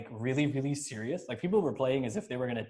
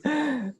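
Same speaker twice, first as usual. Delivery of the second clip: high burst of laughter; 12 semitones higher, 3 dB louder than usual. Real, not acted. Laugh after joke.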